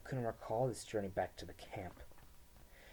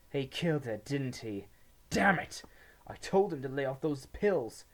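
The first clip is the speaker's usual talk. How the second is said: high, loud grieving rage; angry outburst; 5 semitones higher, 8 dB louder than usual. A narrator's reading. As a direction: curse it out in anger. Twin curses learning of death.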